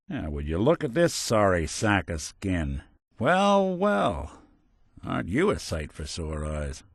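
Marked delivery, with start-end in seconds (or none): 3.2-4.4 high, drawn-out recognition; pleased surprise. Acted. Investigator spotting an old acquaintance, surprised. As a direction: let it ring out high on spotting them. Greeting a familiar face.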